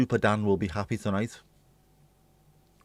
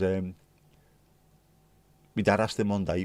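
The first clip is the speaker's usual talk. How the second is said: ordinary talk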